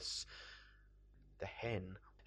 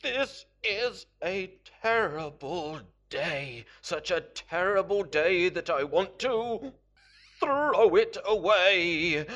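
exasperated misery; 6 semitones higher, 9 dB louder than usual. Acted. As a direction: drag out each word in loud despair. Character bemoans his terrible day.

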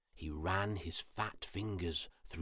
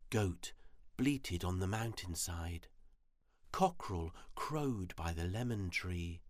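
usual speaking voice

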